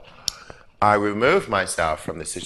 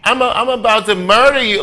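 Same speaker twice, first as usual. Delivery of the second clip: mock menacing thug; 10 semitones higher, 8 dB louder, faster than usual. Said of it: "I'm not trying to be like".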